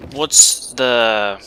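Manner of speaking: ordinary talk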